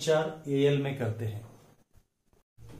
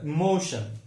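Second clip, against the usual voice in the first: Emotion with MO drawn out and falling; stress on MO; slower than usual. Shown as a demonstration. Teacher showing the stressed syllable, stress on MO. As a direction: say i-MO-shun, leaning on MO. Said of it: "मो पर stress आता है".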